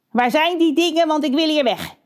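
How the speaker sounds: impatient crow boss. Acted. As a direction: demand it like a greedy crow.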